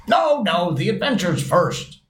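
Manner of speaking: high-pitched, impatient objection. A narrator's reading. Griffin overrules the Mock Turtle's request, impatient. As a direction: cut in, eager to get on. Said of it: "in an impatient tone".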